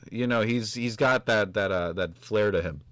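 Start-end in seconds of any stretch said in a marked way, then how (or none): none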